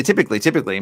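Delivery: usual speaking voice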